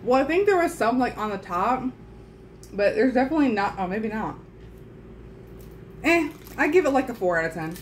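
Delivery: food in mouth; mid-bite chatter; talking while chewing. Real, not acted. Seen 2: Left speaker holds biscuit pieces, jaw chewing between remarks throughout.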